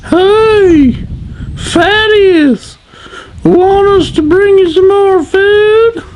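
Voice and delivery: put-on very high, loud taunting child's voice; mocking child. Child mocking the fat king, high and loud. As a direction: jeer like a cheeky kid. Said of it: "One child wailed out".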